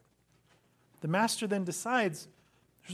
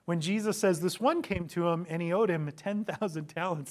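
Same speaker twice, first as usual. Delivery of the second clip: ordinary delivery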